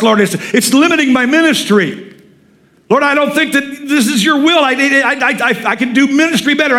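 high, urgent acted prayer; aggressive pleading. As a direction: plead insistently with God, voice held high, stumbling over the words. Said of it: "That's aggressive praying".

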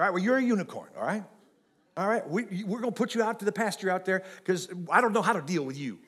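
ordinary talk, nothing marked

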